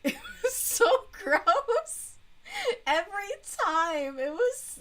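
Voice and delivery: high laugh of disgust. Real, not acted. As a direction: laugh it out, grossed out.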